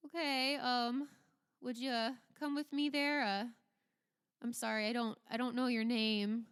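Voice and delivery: halting, apologetic soldier voice; Dwight. Acted. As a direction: ask awkwardly, stumbling over the request. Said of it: "Dwight kinda looks at you and he says".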